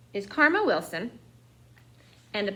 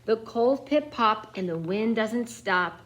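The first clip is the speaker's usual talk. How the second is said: plain reading voice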